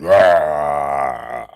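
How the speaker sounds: loud mock rah; hammed-up patriotic cheer.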